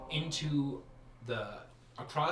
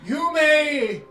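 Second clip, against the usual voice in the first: high, formal butler voice, louder; sheep man butler; 9 semitones higher, 7 dB louder, slower than usual. Acted. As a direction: announce the mistress grandly. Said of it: "the sheep man returns".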